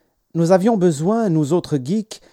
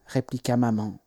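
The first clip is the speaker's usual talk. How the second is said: ordinary delivery, nothing marked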